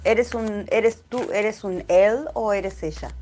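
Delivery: switching into Spanish mid-stream; spanglish. Real, not acted. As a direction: ask it casually in Spanish. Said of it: "sorry for the Spanglish".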